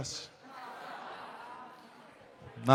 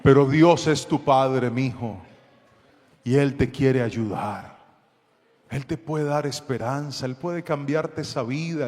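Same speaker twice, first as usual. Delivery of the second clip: gentle pastor. Retold or imitated. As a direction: soothe him like a frightened son. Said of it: "me transformé en pastor".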